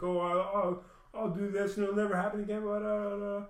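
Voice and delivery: sarcastic mimic of a weak apology; mocking empty promise. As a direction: parody her hollow promises.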